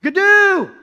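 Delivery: high, loud drawn call; acted shout into the distance; yelling. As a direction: yell for your lost brother. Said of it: "he starts yelling".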